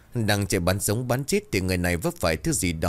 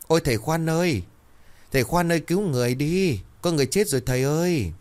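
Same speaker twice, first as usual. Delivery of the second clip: urgent calling out; 8 semitones higher than usual. A narrator's reading. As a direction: call out as if the house is on fire. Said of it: "tiếng gọi như cháy nhà". (gọi thất thanh như cháy nhà)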